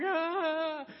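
high, drawn-out pretend weeping cry; mock wail. Crowd weeping over Paul, high hammed-up wail. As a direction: wail like an imitated weeper. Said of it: "crying and they're weeping".

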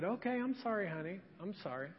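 soft, sheepish sorry, trailing away; quiet apology. Retold or imitated. Wife sheepishly apologizing to husband on phone, quiet. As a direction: apologize quietly, almost under your breath.

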